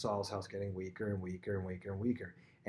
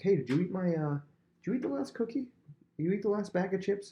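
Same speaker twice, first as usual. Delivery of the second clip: high, light little accusation; mock petty accusation; 9 semitones higher than usual. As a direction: play it as a trivial squeaky complaint. Petty cookie accusation acted out.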